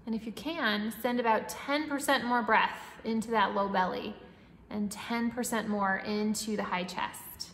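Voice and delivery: calm, even breathing-coach voice; guided meditation. Acted. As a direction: guide the breath gently and steadily.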